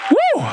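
high celebratory woo; exultant shout of praise; joyful whoop. Real, not acted. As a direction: let out a happy preacher's whoop.

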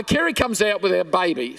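ordinary talk

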